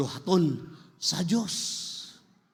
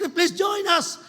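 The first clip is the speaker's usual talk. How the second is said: high-pitched recruiting call; shouting; 9 semitones higher, 4 dB louder than usual. Acted. Orchestra asks people to join, high shouted call. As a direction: call it out as a raised plea. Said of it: "Orchestra is shouting".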